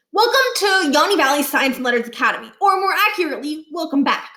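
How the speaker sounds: put-on a boy laying on the charm, a little fast; cheerful kid. A narrator's reading. Niles greeting the principal, eager and cheerful. Niles welcomes Barkin brightly.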